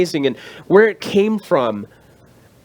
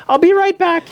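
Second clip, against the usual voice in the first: chirpy lady; 12 semitones higher, 3 dB louder than usual. Retold or imitated. Voicing the closing lady.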